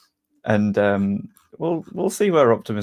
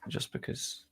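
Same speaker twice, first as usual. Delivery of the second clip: ordinary delivery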